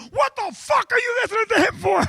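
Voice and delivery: very high, loud, strained shout; outraged boss yell. Retold or imitated. Boss blowing up at his employee without missing a beat, shouting very high. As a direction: blow up in disbelief. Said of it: "Billy goes".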